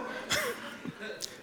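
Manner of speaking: breathy burst of laughter; hearty laugh; real laugh. Real, not acted. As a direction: laugh at the memory. Laughing at own story.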